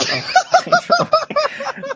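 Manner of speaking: high helpless giggling fit; high burst of laughter. Real, not acted. Laughs at his own story.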